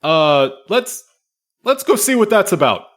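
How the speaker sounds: a guide alarmed by the yelling; Curse, concerned. Acted. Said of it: "Curse says".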